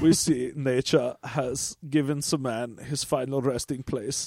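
put-on low, hushed German-accented narrator; mock-solemn documentary voice; Werner Herzog. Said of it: "Werner Herzog comes on and narrates".

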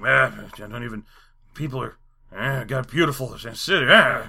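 low, slurred defeated babble; mock burnt-out rambling with groaned ehs; given-up mumbling. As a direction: mumble like you've stopped caring. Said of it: "it's like he gives up".

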